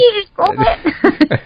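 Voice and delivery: high-pitched playful cartoon impression; Wallace. Finishing the co-host's joke about cheese, high cartoon voice. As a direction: pitch it way up, quoting a cartoon line. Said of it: "make a Wallace and Gromit".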